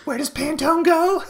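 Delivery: screaming rant in a very high, loud voice; furious screaming manager. Retold or imitated. Manager screaming at the supervisor about the aisle, hammed-up fury. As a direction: blow up at the worker over the aisle.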